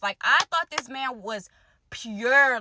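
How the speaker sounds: ordinary talk, nothing marked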